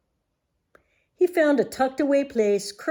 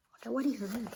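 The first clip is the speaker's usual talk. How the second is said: ordinary delivery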